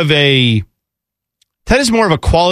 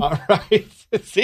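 quiet laugh through words; laughing under breath; stifled giggles; 4 semitones higher, 6 dB quieter than usual. Real, not acted. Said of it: "trying not to laugh".

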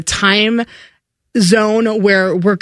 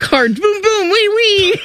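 race car noises; 12 semitones higher, slower than usual. Pretend. Imitating race cars playfully.

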